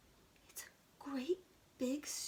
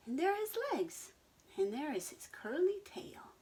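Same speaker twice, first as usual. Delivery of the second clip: higher, faster eager voice; excited pointing; 8 semitones higher than usual. Acted. Henry pointing out the star pig, eager and quick. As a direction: rush to show each part.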